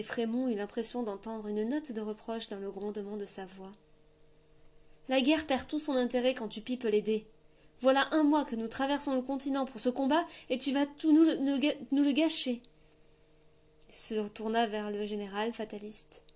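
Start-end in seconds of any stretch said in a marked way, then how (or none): 5.1-12.6 petulant complaint. A narrator's reading. King complaining to grey father.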